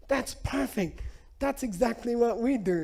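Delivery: high, eager approval; Lama thrilled at a familiar custom; delighted Lama. Retold or imitated.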